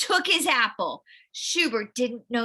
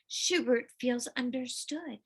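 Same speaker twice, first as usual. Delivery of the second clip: plain reading voice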